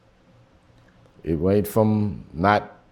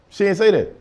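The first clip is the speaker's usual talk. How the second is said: higher and louder, insisting; emphatic denial; 11 semitones higher, 4 dB louder than usual. Shown as a demonstration. Comedian repeating the denial to the audience, higher and louder. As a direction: insist on it the second time. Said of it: "No, she didn't say that".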